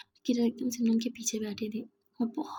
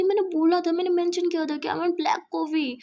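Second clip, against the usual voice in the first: higher, louder insisting; petulant, raised-voice protest; stubborn insistence; 6 semitones higher, 5 dB louder than usual. Acted. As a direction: dig in and argue your point. Petty quarrel over coffee.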